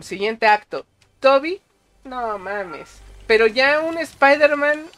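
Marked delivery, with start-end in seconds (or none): none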